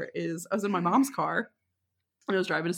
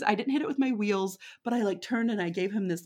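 ordinary talk, nothing marked

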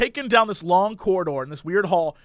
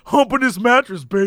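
smug husband voice; 4 dB louder than usual. Pretend. Tom in acted sketch.

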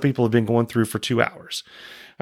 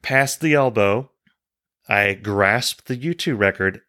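normal talking voice

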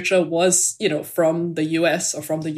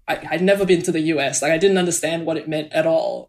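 normal talking voice

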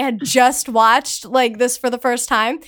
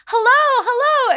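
high, eager fan greeting; eager hello; 11 semitones higher than usual. Retold or imitated. Starstruck fan calling out to her idol, high and eager. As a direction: call out hello like a starstruck kid.

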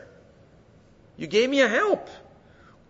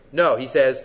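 ordinary talk, nothing marked

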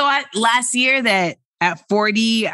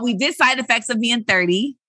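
ordinary talk

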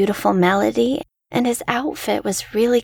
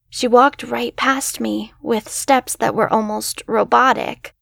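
usual speaking voice

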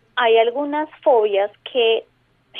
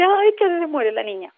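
high, alarmed mother's cry; a touch of a frightened mother crying out; mock wailing mother; 5 semitones higher than usual. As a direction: play the panicked mom by the pool. Mother wailing over rescued child.

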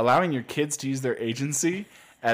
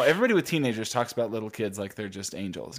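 ordinary talk

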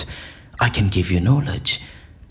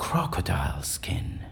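a hushed, breathy whisper; 8 dB quieter than usual. Acted. Prisoner whispers his secret to the agents. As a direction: let it out as a secret.

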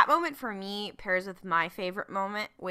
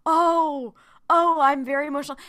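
higher and louder, hammed-up overwhelmed gush; mock emotional; 7 semitones higher, 9 dB louder than usual. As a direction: playfully overdo being moved. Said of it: "I was like".

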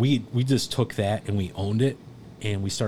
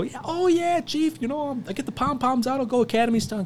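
very high, gushing falsetto; mock eager. Joking back at the chief he thinks is teasing, mock-eager falsetto. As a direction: squeal it like an over-keen cheerleader, clearly put on.

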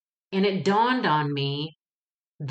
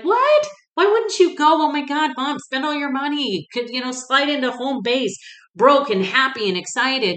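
high, loud, astonished cry of disbelief; high excited squeal at Mom; 8 semitones higher, 5 dB louder than usual. Retold or imitated. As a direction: shriek it out, urging Mom to go. Excited reaction to mom cancelling.

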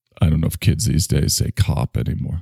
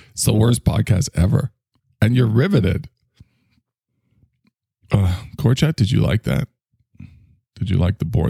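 usual speaking voice

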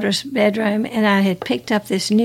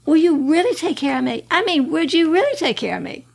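higher, pleading question; 6 semitones higher than usual. Retold or imitated. Woman asking God if he'll care for her, pleading higher. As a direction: plead it up high, in disbelief. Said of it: "And I said".

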